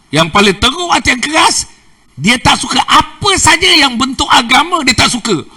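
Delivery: loud, high, fervent preaching; preaching at full force. Real, not acted. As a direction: press each line hard to the crowd. (ceramah berapi-api dengan suara lantang)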